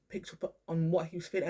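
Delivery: normal talking voice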